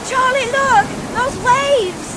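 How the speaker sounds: high, loud cry of alarm over the storm; panicked shout to be heard over the waves; terrified scream. Acted. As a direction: scream a warning at the wall of water. Storm wrecks the boat.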